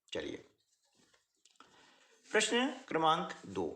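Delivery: usual speaking voice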